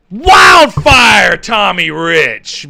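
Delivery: loud, drawn-out wrestler call-out; hyped call-out. Pretend. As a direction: stretch it out in a big shout. Calls out a wrestler's nickname.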